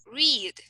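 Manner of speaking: "read" with a long, held ee; long vowel. Shown as a demonstration. Teacher demonstrates a longer sound, long ee in "read". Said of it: "that the sound is longer".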